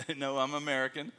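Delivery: ordinary delivery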